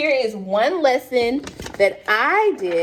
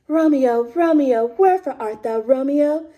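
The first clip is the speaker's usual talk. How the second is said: badly attempted British stage Juliet; attempted British accent; 3 semitones higher than usual. Shown as a demonstration. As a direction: declaim Shakespeare in a barely there British accent. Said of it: "In your British accent".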